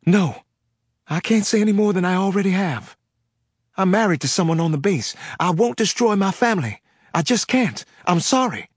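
high, tense Southern pleading; panicked Southern woman. Acted. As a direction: near panic, refusing in a Southern accent. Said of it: "close to panic".

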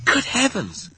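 high startled exclamation; shocked cry. A narrator's reading. Sophie first sees the nightmare, high shocked cry. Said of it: "she cried".

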